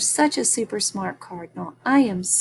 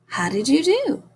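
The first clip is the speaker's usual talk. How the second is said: ordinary talk, nothing marked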